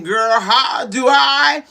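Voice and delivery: stretched-out preacher's shout; rallying shout. Real, not acted. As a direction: holler it out to rally them. Rallying cry to the listeners.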